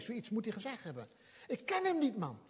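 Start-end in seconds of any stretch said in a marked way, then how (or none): 1.5-2.4 much higher, heated denial; angry denial. Retold or imitated. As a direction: snap back after swearing. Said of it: "En dan vloekt hij zelfs".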